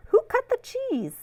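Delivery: ordinary delivery, nothing marked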